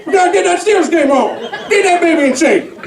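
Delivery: high, fast, excited dad voice; old dad fixing the TV. Retold or imitated. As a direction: bang the TV like a dad before the game. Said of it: "expect him to be like".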